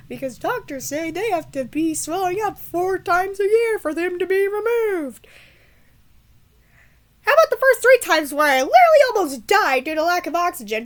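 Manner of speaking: loud, high-pitched indignant outburst; exasperated rant. Real, not acted. Vlogger protesting the doctors' tonsil rule, exasperated rant. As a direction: raise your voice in outraged disbelief.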